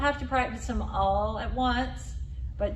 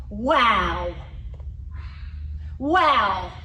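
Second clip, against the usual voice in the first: louder, over-articulated "Wow"; exaggerated wow; 4 dB louder than usual. Shown as a demonstration. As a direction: stretch "Wow" with the whole face. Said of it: "over-exaggerate".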